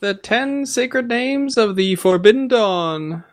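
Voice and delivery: high, slow ringing proclamation; zealous preacher. Acted. Player mimics the mad dockside preacher for the game master, high slow proclaiming. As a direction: intone it like a doomsday preacher, drawn out. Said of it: "That kinda guy, John?".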